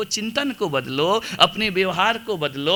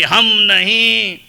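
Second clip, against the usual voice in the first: हम नहीं drawn out long, loud and higher; defiant refusal; 4 semitones higher, 6 dB louder, much slower than usual. Acted. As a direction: stretch हम नहीं into a stubborn vow. न सुधरने की कसम का अभिनय. (हम नहीं को खींचकर, ज़ोर से, अड़ियल अंदाज़ में)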